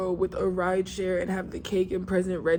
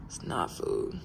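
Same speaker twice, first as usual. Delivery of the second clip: breathy unvoiced whisper, much lower; 12 semitones lower, 4 dB quieter, slower than usual. Shown as a demonstration. Muttering to herself about the mess, whispered. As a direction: whisper it to yourself. Repeats line, second take whispered.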